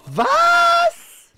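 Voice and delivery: shrill startled what; sudden high yelp of disbelief; surprised. Real, not acted. Gamer shocked by play.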